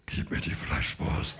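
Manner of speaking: put-on slow, whispery child demand. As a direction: voice a flat little kid.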